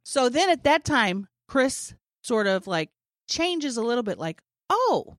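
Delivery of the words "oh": much higher sudden oh; mock surprised oh. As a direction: react as if realizing who they are. Comedian's sudden recognition.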